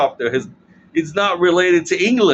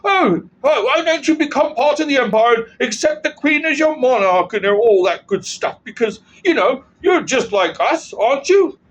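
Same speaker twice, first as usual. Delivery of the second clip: higher, sing-song mock invitation; mocking sweet-talk; 8 semitones higher than usual. Commentator mocking an empire luring Canada, high sarcastic coaxing. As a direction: coax them sarcastically, like a smug empire.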